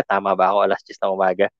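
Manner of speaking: dropped low and soft, checking to himself; soft low aside double-checking the time; quiet aside. Real, not acted.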